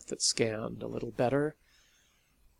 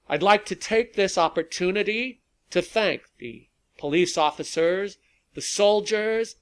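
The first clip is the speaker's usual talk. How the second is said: raised, projected public tribute; high, loud oratory carrying over a crowd; rally speech; 5 semitones higher, 5 dB louder than usual. A narrator's reading. Rally leader thanking police, soldiers and volunteers, orating loudly.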